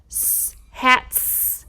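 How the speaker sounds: unvoiced s then hats; hissed s sound. Shown as a demonstration. Teacher showing the S ending of hats. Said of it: "listen to that N sound".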